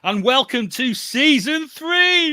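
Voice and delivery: high, slow, loud hype; excited announcement. Acted. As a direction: stretch it out like big news. Host hypes new season.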